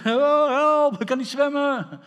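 high, loud drowning panic; panicked cry for help. Acted. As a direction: call out as if going under. Acts a drowning person calling out. (paniekerig om hulp roepen)

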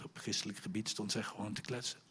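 normal talking voice

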